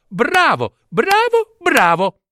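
very high-pitched repeated bravos; gleeful cheering. Acted. King's daughter cheers her chosen husband, gleeful. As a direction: cheer him, leaping highest on the middle one.

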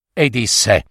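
ordinary delivery

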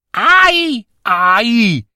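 high-pitched "ouch"; pained yelp. A narrator's reading. Father slapped by unseen hand, yelping in pain.

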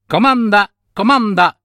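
vocina acuta e pronta; cipolletta magica servizievole. Acted. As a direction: offriti con una vocina alta. (vocina fatata, acuta)

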